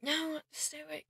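drowsy confused mumble after being drugged; groggy and drugged. Acted. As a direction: come round woozy from chloroform. Waking drugged with chloroform.

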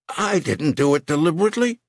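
high and fast denial; panicked pleading. Acted. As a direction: gabble it out in terror.

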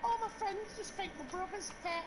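put-on high-pitched quote of a girl; imitated girl. Bobby on her friends fancying her brother, imitated high. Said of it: "she's like".

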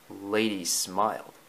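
plain reading voice

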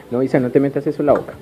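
rapid, hurried warning; quick scolding. Real, not acted. Father quickly stopping toddler from putting something in her mouth, hurried. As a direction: rush the warning out to stop her.

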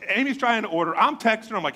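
ordinary talk, nothing marked

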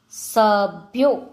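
સભ્યો slowly, split into syllables; slow word demonstration. Shown as a demonstration. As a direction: break up સભ્યો for spelling. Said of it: "જોડણી ઉપર જોજો".

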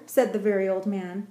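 ordinary delivery

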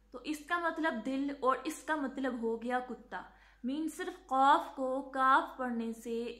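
ordinary talk, nothing marked

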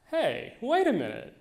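high, loud, dawning triumph; light up like you just found the loophole; excited kid scheming; gleeful realization. Retold or imitated. Child's triumphant realization alone in room.

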